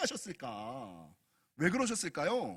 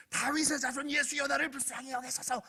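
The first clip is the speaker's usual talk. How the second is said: strained, high-pitched cry of a blind beggar; squeezed, pleading mock shout; mock yelling; 5 semitones higher than usual. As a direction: imitate desperate yelling without full volume. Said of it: "냅다 소리를 지르는 거예요".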